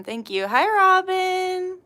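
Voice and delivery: ordinary talk, nothing marked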